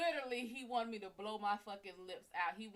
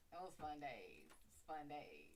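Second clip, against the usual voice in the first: wistful; 7 semitones lower, 13 dB quieter, slower than usual. Real, not acted. Wistful memory.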